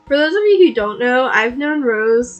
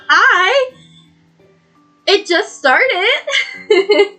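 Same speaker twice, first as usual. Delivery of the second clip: excited; 9 semitones higher, 5 dB louder, much slower than usual. Real, not acted. Excited greeting.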